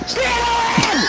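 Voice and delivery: high-pitched imitated sorting-hat scream; retold scream. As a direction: scream it out as the hat's announcement. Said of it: "and then it fucking screams".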